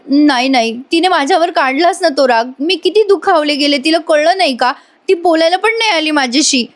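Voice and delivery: high, wounded acted protest; hurt complaint. Mother blamed by daughter tells husband, hurt. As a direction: insist you were wronged, pitch held high.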